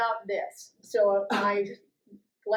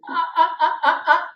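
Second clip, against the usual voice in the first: loud, high startled stammering; flustered stammer; 6 semitones higher, 7 dB louder, much faster than usual. Retold or imitated. Caught by the sergeant, stammering high and loud. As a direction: stumble over sounds in mock panic. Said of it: "And I went".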